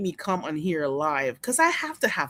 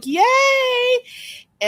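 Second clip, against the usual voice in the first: high, loud, drawn-out "yay"; gleeful squealed cheer; excited cheer; 5 dB louder than usual. Real, not acted. Cheering that viewer joins.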